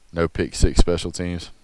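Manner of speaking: ordinary talk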